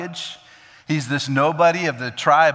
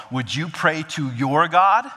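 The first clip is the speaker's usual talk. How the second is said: higher, slower, distant question; people pleading with Samuel; 5 semitones higher than usual. Acted. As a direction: ask as if God is someone else's. People asking Samuel, quote.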